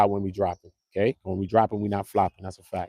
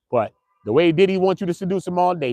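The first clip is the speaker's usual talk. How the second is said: ordinary delivery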